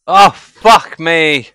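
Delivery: loud, slow, drawn-out curse; exasperated shout. Real, not acted. Streamer cursing at chat in loud exasperation. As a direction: let it out, fed up. Reacts after setting glass down.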